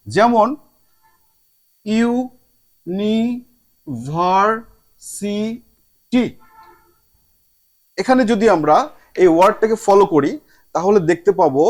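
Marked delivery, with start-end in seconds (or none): 1.9-6.2 "university" in five very slow, separate beats; long pauses within it: "ইউ-নি-ভার-সি-টি"; drawn-out, syllable-by-syllable "university"; split into syllables. Shown as a demonstration. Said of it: "চার ভাগে কিংবা তারও বেশি ভাগে ভাগ".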